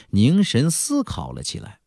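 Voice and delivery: usual speaking voice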